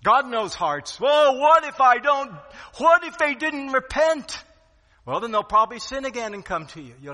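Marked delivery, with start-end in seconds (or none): 1.0-4.4 high, loud protesting voice; whiny objector. Pretend. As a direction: object in a high, pleading whine.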